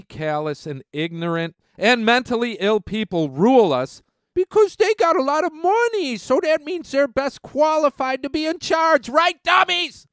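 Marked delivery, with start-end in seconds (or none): 4.4-10.1 high, sneering mock logic; mocking sarcasm. Vlogger ridicules rule by the rich in a high, sneering voice. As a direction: pitch it up to ridicule the rich rulers.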